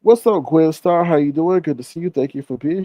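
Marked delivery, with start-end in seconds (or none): none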